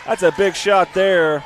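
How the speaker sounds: ordinary talk, nothing marked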